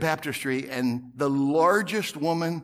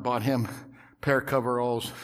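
ordinary talk, nothing marked

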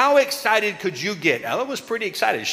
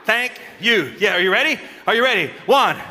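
ordinary delivery

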